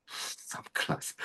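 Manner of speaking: hushed, voiceless whisper; whispered repeat. Real, not acted. Teacher repeats the standard for the students, whispered. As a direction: whisper it as an emphatic echo.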